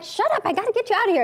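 high, fast mock exasperation; play-acted frantic shushing; harried parent. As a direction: rush it out at squabbling kids. Harried mother snapping at kids.

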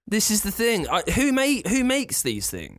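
high, slow exasperated question; baffled outrage. Real, not acted. Exasperated rant about silly days.

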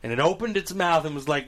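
usual speaking voice

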